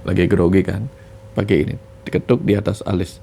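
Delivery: ordinary delivery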